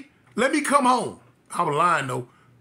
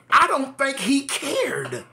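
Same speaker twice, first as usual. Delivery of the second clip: ordinary talk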